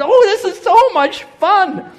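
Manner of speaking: high, loud, drawn-out squeal of fun; gushing delight. Retold or imitated. Husband mimics wife panning for rubies, gushing and high-pitched.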